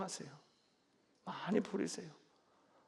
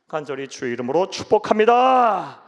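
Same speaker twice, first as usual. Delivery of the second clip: loud preaching blessing, stressing 축복합니다; fervent blessing; 11 dB louder than usual. Acted. Preacher blessing the congregation, loud and fervent. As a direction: proclaim the blessing with force.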